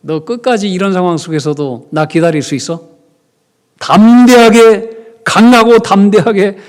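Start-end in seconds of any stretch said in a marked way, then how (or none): 3.8-5.2 slow, drawn-out, much louder and higher; bold exhortation. Acted. Pasteur exhorte l'assemblée. 5.2-6.6 bold exhortation. Acted. Pasteur exhorte l'assemblée.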